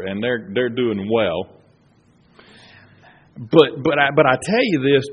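loud, driving sermon delivery; preaching at full force. Acted. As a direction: fire up the congregation.

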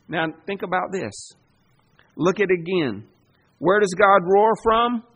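ordinary talk, nothing marked

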